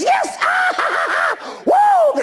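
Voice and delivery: put-on an eleven-year-old girl cheering at the race; joyful girl cheering. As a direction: squeal with delight like a running child. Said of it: "she goes".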